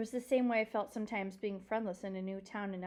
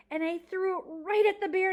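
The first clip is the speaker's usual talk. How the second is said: excited storyteller; 11 semitones higher, 9 dB louder than usual. Acted. Climax of the bear story.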